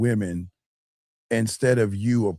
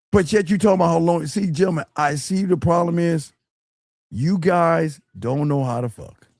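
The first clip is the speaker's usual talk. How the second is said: ordinary talk, nothing marked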